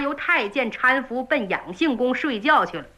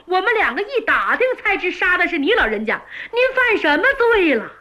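put-on higher, quick, worried young servant; anxious servant boy; 7 semitones higher than usual. Stable boy pleading with his bound master, higher anxious voice. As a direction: rush it out like a frightened stable boy.

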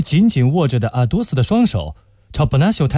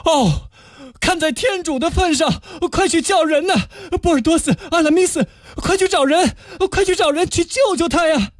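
high, frantic pleading for help; desperate cry. Acted. D'Artagnan begs Porthos and Aramis to fetch help, high and desperate. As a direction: beg the friends to run for a doctor. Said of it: "达达尼昂绝望地喊了起来".

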